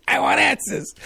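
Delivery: put-on demanding it in a New York accent. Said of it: "I was putting on my New York accent".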